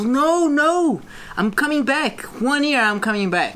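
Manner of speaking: high-pitched, emphatic denial; insistent protest. Retold or imitated. Traveler to doubters before leaving Brazil, insistent protest.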